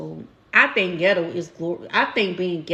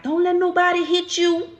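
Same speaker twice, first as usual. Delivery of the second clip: high-pitched, insistent mom voice, imitated; mother coaching her child; 10 semitones higher than usual. Mother to her daughter at daycare age, imitated firm coaching. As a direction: drill it into a small child, pitch held high. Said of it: "I used to be like".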